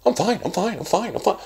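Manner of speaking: high mock chant of I'm fine; mock emphatic. Shown as a demonstration. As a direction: parody yourself overselling that you're fine. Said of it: "I say that so emphatically".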